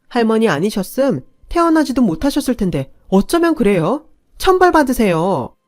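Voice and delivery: loud, high, indignant scolding; angry outburst. Acted. Daughter-in-law scolds the elders before grandma, furious and loud. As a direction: blast them for their cruelty. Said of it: "저도 모르게 화를 냈고".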